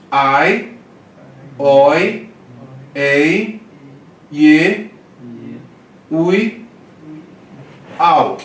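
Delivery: each Greek diphthong said loud and separate; diphthong demo. Shown as a demonstration. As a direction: sound out ai, oi, ei, ie, ui, au as distinct glides. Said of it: "você tem esses ditongos".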